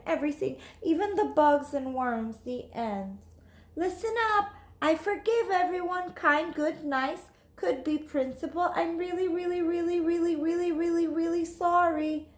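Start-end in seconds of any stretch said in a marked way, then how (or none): 3.8-8.7 higher, bright child pretending to be grown-up; lightly voiced schoolgirl; little girl voice. Voicing child Lily. 8.8-12.3 put-on pleading child. Lily repeating apology.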